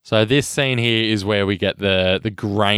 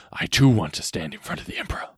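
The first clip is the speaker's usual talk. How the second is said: quoted gladiator line; 6 semitones lower, 5 dB quieter, faster than usual. Acted. Quoting Maximus's grave film line.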